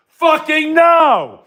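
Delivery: high, slow, stretched-out yell; angry drawn-out shout. Real, not acted. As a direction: hammer out every word in rage. Ranting angrily at TikTokers.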